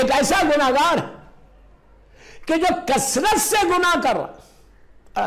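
high thundering voice of God; booming divine voice. Acted. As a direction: proclaim it from above, loud. Voice of Allah, slow emphasis.

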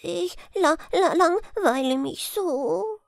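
high, slow stammering little hare; ashamed stutter. Acted. Little hare caught sneaking off, ashamed stammer. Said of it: "stotterte er ganz beschämt".

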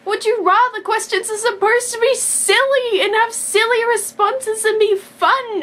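high, loud passionate protest, slowing on the last words; excited rant. Retold or imitated. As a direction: argue loudly that the questions should be silly. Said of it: "But I'm like".

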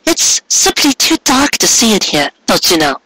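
high, hammed-up complaint of a coy mare; high teasing mock fuss; mock distress. A narrator's reading. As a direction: pretend to be bothered, playfully, pitched very high. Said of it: "faking discomfort".

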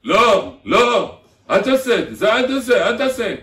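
loud, high-pitched yelling of a prohibition; acted-out angry telling-off; shouted scolding. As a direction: hammer each command out at a shout. Acts out yelling don't at someone.

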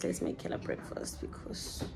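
breathy whisper, no voice. Shown as a demonstration. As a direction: whisper it to yourself. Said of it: "repeats line, second one whispered".

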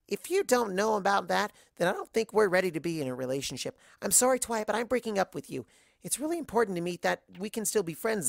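high, bubbly, overexcited party pony; high giddy pony gushing about kissing; Pinky voice. Acted.

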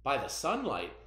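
slow, hushed folk tale narration with bright peaks; storytelling read-aloud. Acted. Teacher reading a folk tale to her students, slow and hushed.

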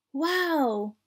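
put-on high, delighted little-girl exclamation; excited exclamation. A narrator's reading. Vampire girl getting her candy back, excited exclamation. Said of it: "exclaimed the vampire girl".

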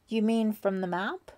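puzzled question with rising end; confused. A narrator's reading. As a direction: check it, a little thrown. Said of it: "suddenly confused".

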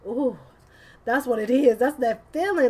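light up at the cards; a loud, high, delighted ooh; excited realization. Real, not acted.

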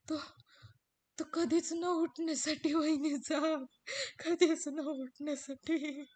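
loud, slow sobbing words; crying out the loss. Acted. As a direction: break down on it. Ramu मृत्यूची बातमी देतो. (रडत मोठ्याने मृत्यूची खबर)

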